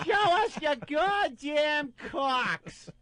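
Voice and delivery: high, slow shouted demand; drunk woman yelling. Retold or imitated. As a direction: yell it at the guys, drawn out. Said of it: "one of 'em goes".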